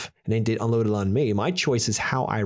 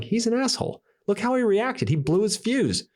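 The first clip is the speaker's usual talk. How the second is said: indignant customer; 10 semitones higher than usual. Retold or imitated. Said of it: "'cause you're like".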